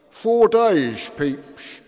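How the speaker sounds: high, loud slurred call; drunken shout. Acted. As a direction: yell it from the floor. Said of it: "shouted a drunk".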